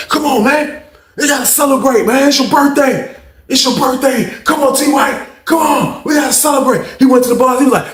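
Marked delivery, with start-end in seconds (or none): 0.1-6.9 high, insistent imitation of a hyped cellmate; hyped urging. Cellmate demanding a birthday celebration, hyped and pleading. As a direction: push the party with high, repeated calls. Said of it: "he just all fucking hyped".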